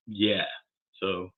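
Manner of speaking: normal talking voice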